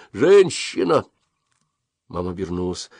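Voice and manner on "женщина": put-on a drunk man's hoarse call, higher and louder; hoarse shout. A narrator's reading. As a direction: bark out "Женщина!" hoarsely. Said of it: "хрипло крикнул".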